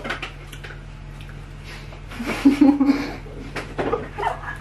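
soft helpless giggle; breathless laugh. Real, not acted. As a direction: laugh along quietly. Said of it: "my son's trying not to laugh".

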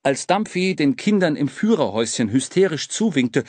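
ordinary delivery

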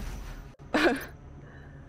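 high breathy laugh; real amused laugh. Real, not acted. Amused after conceding the stereotype is true.

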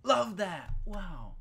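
loud, high mock enthusiasm; sarcastic cheer. Real, not acted. Gamer finding it wet and dark, loud sarcastic cheer. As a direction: exclaim it with fake delight.

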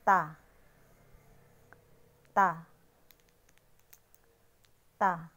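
teacher modelling "ta"; Chinese word ta. Shown as a demonstration. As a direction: model "ta" with a sharp, loud falling tone. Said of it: "kung paano ito basahin".

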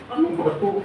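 high mock disbelief; mock bewildered. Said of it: "Kaya sabi ko".

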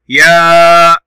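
يا held long, high and loud; loud sustained يا; long vowel. Shown as a demonstration. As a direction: stretch the aa of يا. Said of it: "صوت طويل".

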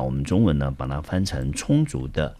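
ordinary talk

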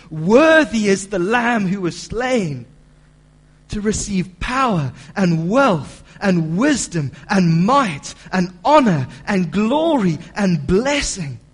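loud, slow, ringing cry of praise; loud proclamation. Acted. Preacher voicing the angels' praise, loud ringing cry. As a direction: proclaim it like a heavenly host. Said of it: "saying with a loud voice".

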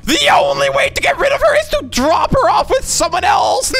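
very high, straining shout; hammed-up yelling. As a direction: yell it way up high, playfully frantic. Playful yelling in game prank.

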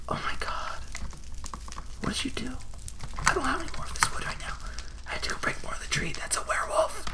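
soft, quick unvoiced whisper; nervous whisper. Real, not acted. Gamer to viewers at night near monsters, nervous whisper. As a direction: whisper so the monsters won't hear.

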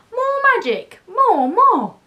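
high, loud eager demand; excited cry. A narrator's reading. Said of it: "the cats cried excitedly".

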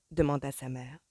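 ordinary delivery

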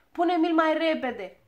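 high, loud urging; fretful impatience. A narrator's reading. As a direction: fuss and hurry him along. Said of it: "se frământa Ochii Albaștri". (nerăbdător, cu glas ascuțit)

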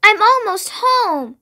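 high, excited storybook bee; excited cry. A narrator's reading. Greedy bee hears rushing water, high excited cry. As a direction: cry out with excitement, pitched well up. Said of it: "cried the greedy bee excitedly".

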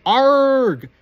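high, loud cry of burning pain; pained scream. Acted. Quirrell screaming as Harry's hands burn him, high and loud.

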